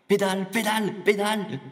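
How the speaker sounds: high, loud repeated shouts; shouted cheering. Acted. Joke's father shouting to his son on the bike, high excited yell. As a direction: yell it out like egging on a rider.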